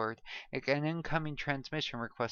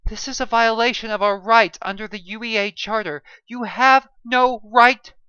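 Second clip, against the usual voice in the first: high, loud indignant protest; outraged scream; 11 semitones higher, 15 dB louder than usual. A narrator's reading. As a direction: scream the charter at the captain. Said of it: "Sonia screamed".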